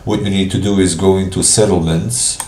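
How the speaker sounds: ordinary talk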